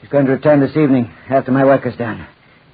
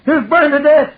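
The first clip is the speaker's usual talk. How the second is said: high, half-sobbing frenzy; crazed cry; 12 semitones higher than usual. Acted. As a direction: cry it out like a madman at the end. Maniac screams his triumph.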